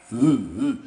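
loud low ape grunts; gorilla grunts. Acted.

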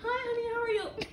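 a hint of a cooing housewife; sweet housewife.

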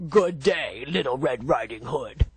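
low, put-on wolf voice; the wolf sweet-talking the girl, low; sly wolf. A narrator's reading. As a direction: greet her like a sly wolf.

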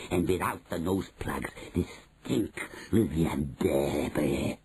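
put-on accented, commanding witch voice with swooping pitch. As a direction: give orders as a relishing, sinister witch leader. Grand High Witch gives orders.

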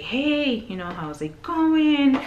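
high, drawn-out friendly greeting; cheerful cashier. Retold or imitated. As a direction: imitate a bright, chirpy cashier saying hello. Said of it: "always bright and cheerful".

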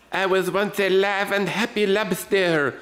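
slow, high lobster in a bad French accent. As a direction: ham up a terrible French accent. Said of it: "French accent for some reason".

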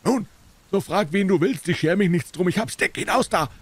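laut und hoch schimpfen; wütendes Fortjagen. A narrator's reading. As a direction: den Bittsteller zornig davonjagen. Dealer angrily chases man away. (erboster Händler)

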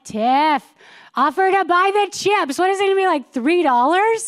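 imagined inner voice. Pretend. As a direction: her conscience chiming in, a step up in pitch. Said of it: "there was no thought, like".

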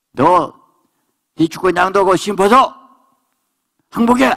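high, loud command to surrender, rising to a cry on 항복해; commanding shout. Acted. Preacher voicing God, shouting at the believer to surrender. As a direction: bellow the order down from heaven.

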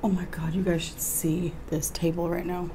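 hushed whisper to the camera. Real, not acted. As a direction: let it out under your breath, all air. Whispering about the table while eating.

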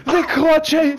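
high, loud repeated cheer; triumphant shout. Real, not acted. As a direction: cheer again, still elated. Celebrating the ace.

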